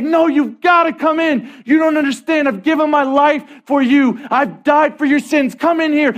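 high, impassioned shouted plea; acted, forceful entreaty; urgent pleading. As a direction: beg them to get inside. Jesus pleading with refusing passer-by.

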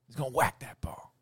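raspy unvoiced Godfather whisper. Mobster commentator at the golf, whispering like the Godfather. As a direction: breathe it like the Godfather. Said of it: "I'll do Godfather".

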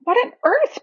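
high-pitched, baffled outburst; startled boy in a story; startled exclamation. A narrator's reading. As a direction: burst out in alarm, pitch leaping up. Peter startled by invisible pulling.

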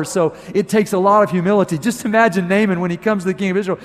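normal talking voice

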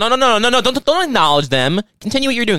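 high eager urging; excited. Real, not acted. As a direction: hurry him on, excited.

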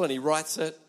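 usual speaking voice

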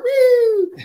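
very high, loud "woo"; imitated siren whoop. Teller mimicking sheriff tearing off in pursuit, high whoop. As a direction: whoop like a car taking off.

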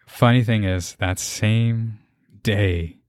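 ordinary delivery

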